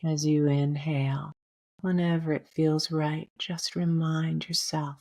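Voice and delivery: gently guiding a breath meditation, unhurried; guided meditation. Acted. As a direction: ease the listener into calm breathing. Leads a meditation.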